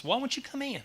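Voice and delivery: high pleading father's question. Acted. Father begs his sulking son, high and pleading.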